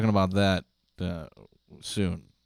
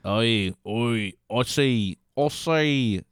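higher, slow mock Aussie accent; hammed-up Australian; 6 semitones higher, slower than usual. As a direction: play a cartoon Australian greeting a mate.

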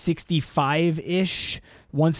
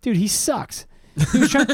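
normal talking voice

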